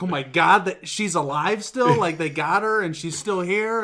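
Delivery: high, loud astonished outburst; shocked. Retold or imitated. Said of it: "I was like".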